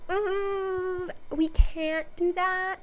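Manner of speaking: high, slow regal refusal; queen voice. Retold or imitated. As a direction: let her down like a haughty queen.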